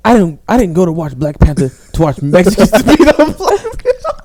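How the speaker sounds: laughing quote. Retold or imitated. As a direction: lose it while quoting. Retelling a remark, cracking up.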